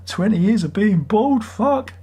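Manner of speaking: exasperated. Real, not acted.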